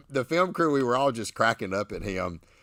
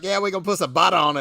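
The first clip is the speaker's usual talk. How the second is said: fast mock Boston accent, butter said bah-tuh; imitating a New England pitmaster, quick and light; Boston accent impression; 8 semitones higher, 7 dB louder, much faster than usual. Host imitating Bill teaching class, mock Boston accent. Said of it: "his accent".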